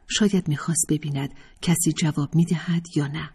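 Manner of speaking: usual speaking voice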